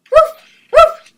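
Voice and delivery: high, loud yappy woof; puppy barking. Acted. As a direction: yap like a noisy puppy. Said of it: "He barked at the little carol".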